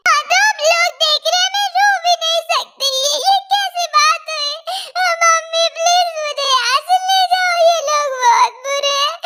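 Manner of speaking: high tearful whine; whiny crying girl. Acted. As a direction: sulk and whine high like a hurt child. Girl keeps crying at onlookers.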